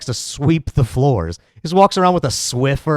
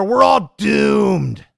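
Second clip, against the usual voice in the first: mock doom shout; 8 semitones higher than usual. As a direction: wail the doom like a hammed-up alarmist.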